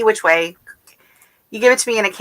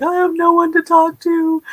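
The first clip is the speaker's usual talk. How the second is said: mock lonely whine; 10 semitones higher than usual. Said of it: "and I was just like".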